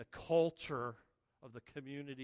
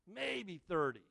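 normal talking voice

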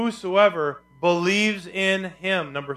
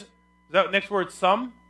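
ordinary talk, nothing marked

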